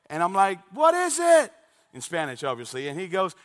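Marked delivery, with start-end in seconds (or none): 0.7-1.5 high, raised calling voice; yelled question. Retold or imitated. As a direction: holler back to a friend. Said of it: "I would scream at Adrian".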